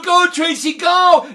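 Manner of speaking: loud high shouting cheer for a runner. Retold or imitated. Crowd in the bleachers urging Tracy to run, shouting. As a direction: yell it from the bleachers.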